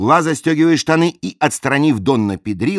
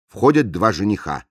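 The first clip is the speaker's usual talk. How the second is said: ordinary talk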